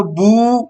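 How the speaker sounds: "بو" with a long, held "oo"; drawn-out vowel. Shown as a demonstration. Teacher demonstrating pesh turning into waw, "بو" held long. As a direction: stretch the vowel of "بو" slowly. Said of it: "اس کو کھینچ کر پڑھتے ہیں".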